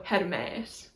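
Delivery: "Hermes" as her-MEHS, tapped r; short first e, long drawn second e in "Hermes"; Greek pronunciation. Shown as a demonstration. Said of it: "treat it as where the stress is".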